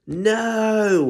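high, loud howl of dismay. A narrator's reading. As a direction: let out a big dog's wail. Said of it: "howled Bob".

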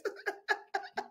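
high-pitched breathless giggles. Real, not acted. Streamer still giggling at the clip, high laugh. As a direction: keep giggling helplessly.

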